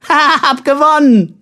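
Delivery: put-on high, loud childish victory cry; triumphant. A narrator's reading. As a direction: crow that you've won. Child triumphs as door opens.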